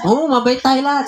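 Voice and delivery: high, laughing agreement; laughing reply. Retold or imitated. Pastor laughing back at his friend, pitched high.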